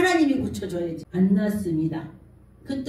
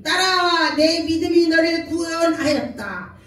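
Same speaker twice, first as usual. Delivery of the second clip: Jesus proclaiming; 5 semitones higher, 3 dB louder, slower than usual. Acted. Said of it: "예수께서 이르시되".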